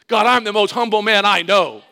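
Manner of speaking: high, very loud protest; mock-indignant boast. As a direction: shout your humility to the heavens, comically proud. Boasting of humility, protesting loudly.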